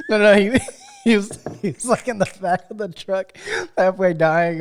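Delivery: real laughter breaking up the words; laughing. Real, not acted. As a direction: tell it through laughter, loud and high. Cracking up while retelling a scene.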